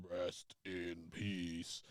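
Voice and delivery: very low, slow, quiet growl of doom; Undertaker. Retold or imitated. Cohost mimicking a wrestler's sign-off, deep slow mumble. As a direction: drag it out, deep and hushed. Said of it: "mumble about ten lines and then end it with".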